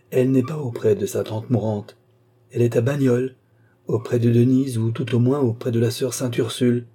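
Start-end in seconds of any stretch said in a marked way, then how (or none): none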